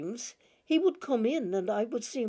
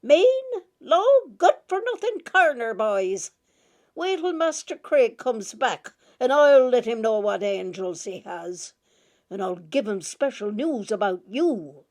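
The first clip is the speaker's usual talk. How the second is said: scolding mistress; 3 semitones higher, 9 dB louder than usual. A narrator's reading. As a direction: tear into the boys like an outraged teacher. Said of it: "as she upbraided us".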